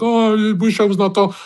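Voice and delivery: high-pitched mock whining; put-on crybaby wail; mock crying. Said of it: "they're crying and they're like".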